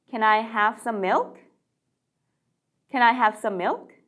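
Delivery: slow, rising "Can I have some milk?"; slow model question. Shown as a demonstration. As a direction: say it slowly for learners to repeat. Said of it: "다시 한번 연습해 볼까요". (천천히 따라 하기 시범)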